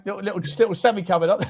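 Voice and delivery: usual speaking voice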